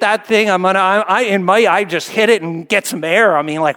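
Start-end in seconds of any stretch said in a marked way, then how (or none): none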